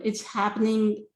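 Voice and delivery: ordinary talk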